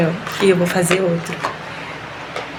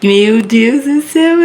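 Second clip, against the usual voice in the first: louder, slow, rising cry of disbelief; overwhelmed exclamation; 3 semitones higher, 6 dB louder, slower than usual. Real, not acted. Woman cries out to God at her positive test. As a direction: let it burst out slowly, rising.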